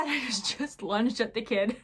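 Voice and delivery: amused at your own outburst; laughing while talking. Real, not acted. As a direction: laugh through the words. Laughs at her own embarrassing story.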